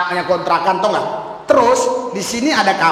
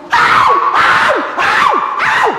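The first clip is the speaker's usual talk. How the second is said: high shouted au au, imitating riders screaming on the ride; mock ride screams; 4 dB louder, much slower than usual. Preacher imitates riders on the ride with high mock screams. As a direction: shriek like you're tumbling on a fairground ride.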